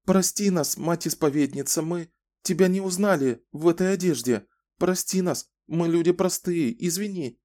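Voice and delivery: high, fearful begging voice; trembling hushed pleading. A narrator's reading. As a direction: beg for forgiveness, frightened. Frightened woman begs forgiveness.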